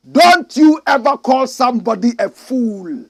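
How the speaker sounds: loud, high scolding; preacher scolding the flock loudly; stern warning. Acted. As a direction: wag the finger at the congregation.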